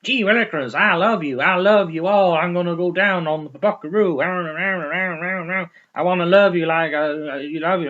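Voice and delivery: hammed-up American country singing; exaggerated American twang, mocking fake singers; mock American accent. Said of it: "singing in an American accent and going".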